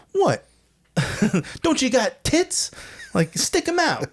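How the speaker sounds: high, brash mock voice; Hatchet's brash line. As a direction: play the tough girl teasing. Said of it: "Hatchet's like".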